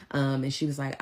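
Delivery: normal talking voice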